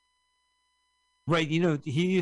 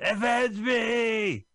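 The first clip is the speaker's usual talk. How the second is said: even higher, slower dying wail; overacted death scene; 11 semitones higher, much slower than usual. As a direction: stretch it out like a ham actor dying. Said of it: "He's like".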